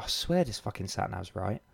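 mumbled low and soft under the breath; muttered driver aside. Retold or imitated. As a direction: grumble it to yourself at the wheel.

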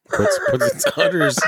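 high cracking laughter through words; squealing laugh. Real, not acted. Host cracks up at the udders joke, squealing laugh.

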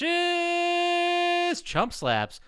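drawn-out, high game-show intro; hammed-up announcer; segment announcement. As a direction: stretch it out high like a jingle.

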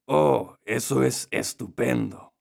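slow, cunning wolf; sly wolf voice. A narrator's reading. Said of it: "dijo el astuto lobo". (voz de lobo astuto)

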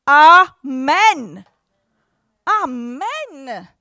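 loud ringing amen; shouted amen. Real, not acted. Preacher shouts amen at the congregation. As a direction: call it out to the room.

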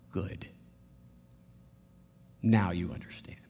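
low, hushed and quietly approving; soft approval. Retold or imitated. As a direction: say it gently and low, like a wise friend. Friend answers softly, approving.

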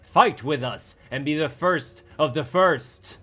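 powerful, angry call to arms; angry roar. A narrator's reading. Strong voice urging others to fight with them, angry. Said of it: "a roar of anger more than anything else".

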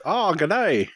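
high-pitched Irish attempt gone Australian; botched Irish accent. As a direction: greet in a hammed-up, Australian-sounding Irish accent. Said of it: "I go Australian or, or Jamaican".

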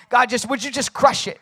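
loud, high mock begging; exasperated plea. Believer demanding God crush things, exasperated mock plea. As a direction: beg impatiently, voice pushed up high and loud.

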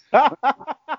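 a sudden, very high laugh; high laugh bursting out. Real, not acted. Laughing while retelling the instructor's words. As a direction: crack up laughing mid-story.

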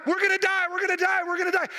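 high, repetitive mock panic; losing their mind. Disciples in the storm-tossed boat, imitated panic. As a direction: imitate frantic wailing on a high pitch. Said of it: "they're just losing their mind".

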